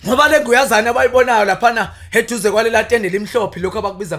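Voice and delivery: loud declaimed praise poetry; poem recitation. Acted.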